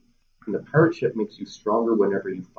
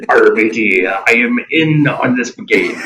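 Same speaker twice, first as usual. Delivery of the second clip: pirate voice; 6 dB louder, slower than usual. Acted. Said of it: "Do your pirate voice".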